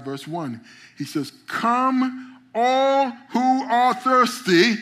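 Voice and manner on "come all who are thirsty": high, loud, drawn-out call; booming call. Acted. Preacher calling the crowd to come, loud and slow. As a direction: cry it out like a prophet calling the thirsty.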